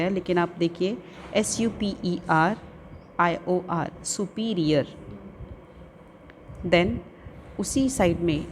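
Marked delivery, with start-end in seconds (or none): 4.0-4.9 "सुपीरियर" as a distinct model word; careful pronunciation. Shown as a demonstration. Teacher adding a word after spelling it, careful pronunciation. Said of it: "listen to the pronunciation of the".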